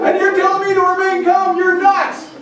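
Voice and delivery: high, loud panicked disbelief; panicked victim. Acted. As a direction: play the bitten hiker freaking out. Acting out panicked snakebite victim.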